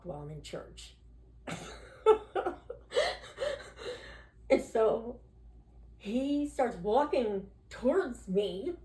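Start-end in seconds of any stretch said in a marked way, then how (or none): 1.5-4.2 high unvoiced giggle; breathy high laugh. Real, not acted. Laughing at herself cursing in church, breathy high giggle. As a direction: let a breathy laugh escape.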